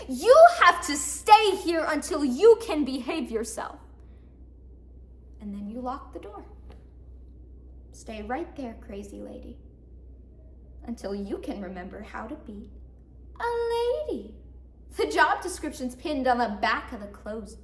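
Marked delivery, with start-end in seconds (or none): 0.1-3.8 loud, emphatic telling-off; scolding parent. Acted. Mother putting the bad kid in the room, scolding. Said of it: "and you say". 7.9-12.7 low, hushed command; controlled order. Acted. Jailer to crazy lady behind locked door, quietly controlling. 13.4-14.3 high, held prim note; mock ladylike. Jailer to crazy lady, high mock-ladylike. Mocks the demanded ladylike behavior.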